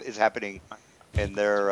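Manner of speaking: normal talking voice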